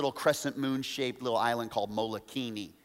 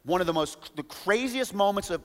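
ordinary talk, nothing marked

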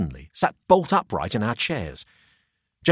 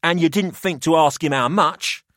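put-on higher, smug older host; a wry businessman weighing up the boys; Pelham's voice; 7 semitones higher, 5 dB louder than usual.

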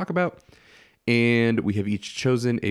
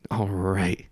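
whisper; 2 semitones lower than usual. Acted. Awkward whisper after silence.

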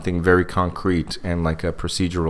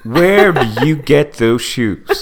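high, loud, drawn-out cooing question; mock admirer; 6 semitones higher, 5 dB louder, much slower than usual. Boardroom man ignoring her presentation for her shoes, mock-gushing. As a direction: stretch it out in a put-on gushing voice.

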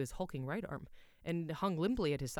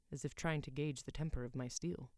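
usual speaking voice

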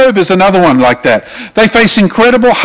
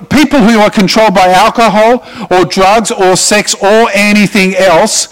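ordinary talk, nothing marked

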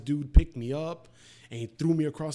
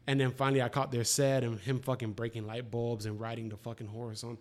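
normal talking voice

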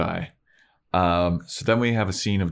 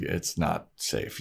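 hushed warning; 3 semitones lower, 7 dB quieter, slower than usual. Retold or imitated. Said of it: "She's like".

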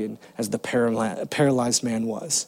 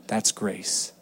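much softer, lower gentle words; tender; 7 semitones lower, 9 dB quieter than usual. Retold or imitated. Father face to face telling his son about grace, tender. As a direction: say it close and gentle.

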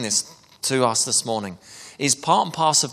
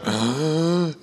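a sharp, higher in-breath gasp; mock gasp of recognition; 4 semitones higher than usual. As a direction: gasp as if caught out by the truth. Mimicking the congregation's reaction.

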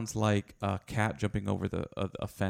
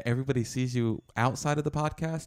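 normal talking voice

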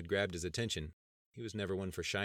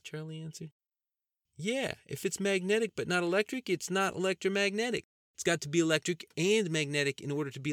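goofy cousin Andy; 10 semitones higher, 5 dB louder than usual. Acted. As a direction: play the joking country cousin up high. Andy's character voice.

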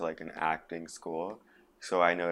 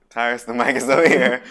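loud, raised crew voice, breaking into laughter; mock calling out; 4 semitones higher, 15 dB louder than usual. Crew correcting actor on mic, laughing mock shout. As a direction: call it out loudly while laughing.

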